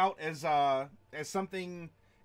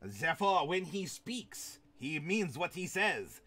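put-on French accent. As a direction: speak as a hammed-up Frenchman, taking time.

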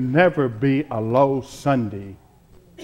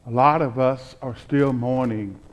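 ordinary talk

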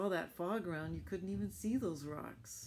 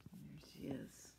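hushed whisper; 10 dB quieter than usual. Real, not acted. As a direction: point her out in a whisper. Pointing to picture.

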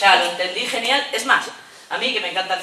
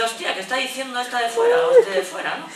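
laughing fetus voice; 8 semitones higher than usual. Retold or imitated.

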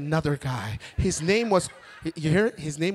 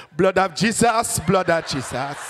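chanted Ghanaian prayer warm-up; fervent Ghanaian call, falling on each repeat; Kwesi from Ghana; slower than usual. Retold or imitated. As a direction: intone it slowly in a Ghanaian accent. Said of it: "He had to warm them up".

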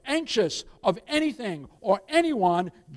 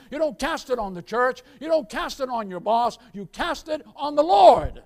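preaching at full force; 7 dB louder than usual. Acted.

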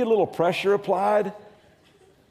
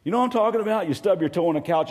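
usual speaking voice

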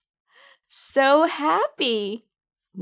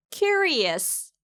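"Curious" with the first syllable CU high, then falling; stress on CU; 5 semitones higher than usual. Shown as a demonstration. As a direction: hit the opening "kyu" of "Curious". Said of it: "Q에 강세를 넣어". ("Curious"의 첫 음절 '큐'에 강세)

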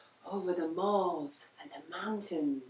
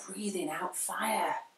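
ordinary delivery, nothing marked

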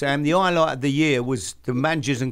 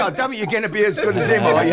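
higher and louder, laughing through the words; 7 semitones higher, 8 dB louder, faster than usual. Real, not acted. Telling Sam about meeting Bill Nicholson, laughing through it. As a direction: quote the manager while cracking up. Laughing while retelling the scout's jibe.

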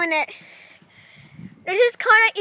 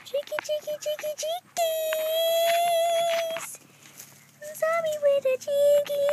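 cooing to chickens; 9 semitones higher, 7 dB quieter than usual. Real, not acted. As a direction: coo in a tiny high voice. Baby-talking to the chickens.

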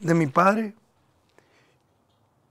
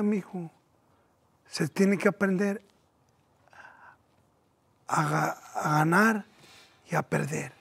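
soft fatherly lesson with long pauses; gently steady a crying child, quietly; consoling father; 6 dB quieter than usual. Retold or imitated. Father teaches his son about losing, soft consoling voice.

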